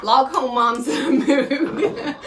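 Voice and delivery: slow, giggling announcement; can't keep a straight face; laughing through it. Real, not acted. Vlogger announcing her bad mood to viewers, laughing. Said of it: "can't even say that with a straight face".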